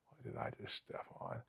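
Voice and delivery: barely audible, whispered to himself; hushed disbelief. Retold or imitated. Officer to himself at a grim scene, hushed disbelief. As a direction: whisper it as a dawning, queasy realization. Stunned at stepping on something.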